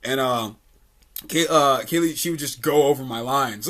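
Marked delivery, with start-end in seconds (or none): none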